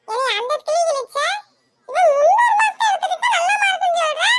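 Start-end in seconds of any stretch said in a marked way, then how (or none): 1.9-4.3 much higher and louder, indignant cry; outraged protest. Acted. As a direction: yell it like the unfairness is unbearable. Sister outraged at mother's unfairness. (அநியாயம் தாங்காமல் உரத்த கோபக் குரல்)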